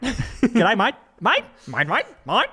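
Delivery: mock Australian. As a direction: tease with a hammed-up Aussie greeting. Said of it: "That's Australian".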